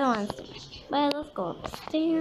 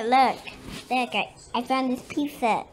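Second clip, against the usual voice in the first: ordinary delivery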